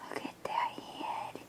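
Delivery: soft hushed whisper; awed whisper. Real, not acted. Admiring the doll's head for viewers, awed whisper.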